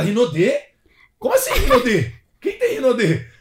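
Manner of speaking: high, loud incredulous outburst; high-pitched what do you mean; shocked disbelief. Retold or imitated. Wife's shocked reaction retold.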